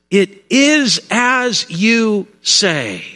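very slow, measured, word by word; profound reply. Acted. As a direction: give each word its own weight. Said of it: "Jesus said something profound in response".

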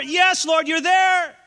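high, loud ecstatic shout; prophet's shout. Acted. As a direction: cry out sure God is in the fire. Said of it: "in the midst of that fire".

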